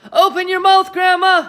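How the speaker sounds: high, loud urgent call; cried out. A narrator's reading. George calls to grandma with the water jug, loud urgent call. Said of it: "he cried".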